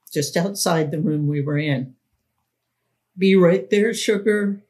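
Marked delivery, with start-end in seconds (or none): 3.2-4.6 louder, higher, breezy reply; answering call. Retold or imitated. The man calling back to Minnie, louder and breezy. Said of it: "he answered".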